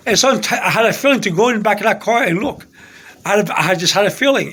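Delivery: ordinary talk, nothing marked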